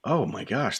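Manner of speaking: ordinary delivery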